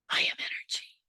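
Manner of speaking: airy whisper, the I leaned on; whispered declaration. Shown as a demonstration. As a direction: breathe the declaration out. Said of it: "But it's the I, capital A, capital M".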